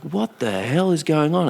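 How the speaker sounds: retold shock at the rushing police; mock stunned question; bewildered. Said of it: "She's like".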